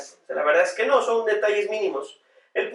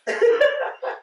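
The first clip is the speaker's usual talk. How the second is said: high laugh. Real, not acted. Laughs at own childhood cheek.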